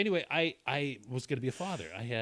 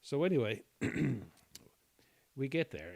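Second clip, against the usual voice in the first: usual speaking voice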